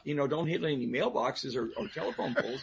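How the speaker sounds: ordinary delivery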